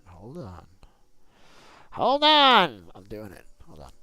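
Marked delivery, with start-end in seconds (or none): 1.9-2.9 yelling. Real, not acted. Impatient yelling at teammate.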